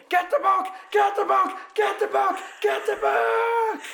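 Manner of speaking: high, screeching, repeated bratty demand; mock tantrum yell. Mimicking the boy yelling at his dad for the book, screeching. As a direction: scream it like a spoiled brat.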